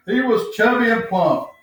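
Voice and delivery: ordinary delivery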